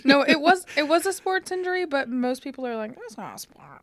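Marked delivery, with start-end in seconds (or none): 3.0-3.8 low, soft dismissive aside; imitating a dismissive sceptic. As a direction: lower your voice and dismiss it. Voicing dismissive people.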